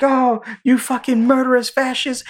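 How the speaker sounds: very high imitation of a furious woman; mock hysterical yelling. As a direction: shriek the accusation, hammed up. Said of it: "like, yelling".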